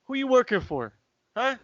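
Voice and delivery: high-pitched mock interrogation; paranoid dad. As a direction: imitate a suspicious father questioning his son. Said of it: "he's too paranoid".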